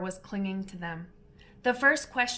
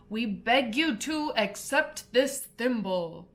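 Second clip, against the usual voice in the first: slow solemn dodo; 2 semitones higher, slower than usual. A narrator's reading. Dodo's formal presentation.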